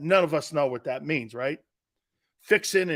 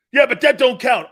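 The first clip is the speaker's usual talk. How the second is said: defensive critic; 7 semitones higher, 10 dB louder than usual. Retold or imitated.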